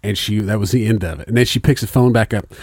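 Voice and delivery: ordinary talk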